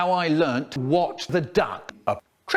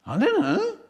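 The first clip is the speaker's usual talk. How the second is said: put-on child's reply. Narrator voicing himself as a boy, high. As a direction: pitch it up like a small child. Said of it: "Then I said".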